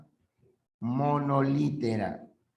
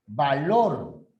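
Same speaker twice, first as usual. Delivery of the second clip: VALOR with the force of the voice on LOR; stress on second syllable. Shown as a demonstration. Teacher showing the tonic syllable, VALOR stressed on LOR. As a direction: lean hard on the last syllable of VALOR. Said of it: "La mayor fuerza de voz recae en la segunda".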